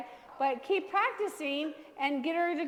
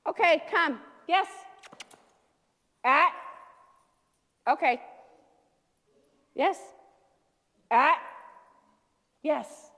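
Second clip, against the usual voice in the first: loud, short commands to the dog; 5 dB louder, slower than usual. Shown as a demonstration. Trainer demonstrating markers with Ranger, loud and short. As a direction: call the dog in firmly. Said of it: "Let me show you with Ranger".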